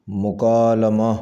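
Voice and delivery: مکالمہ with a short a after l, "mukaalama"; correct pronunciation. Shown as a demonstration. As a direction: say مکالمہ as mu-kaa-LA-ma. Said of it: "صحیح تلفظ ہے".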